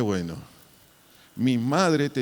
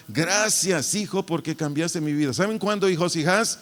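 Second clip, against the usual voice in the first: rising rhetorical build; 6 semitones higher than usual. Real, not acted. Preacher builds rhetorical questions.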